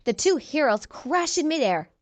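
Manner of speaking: ordinary delivery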